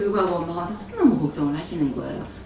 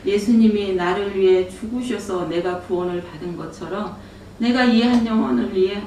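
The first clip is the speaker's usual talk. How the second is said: ordinary talk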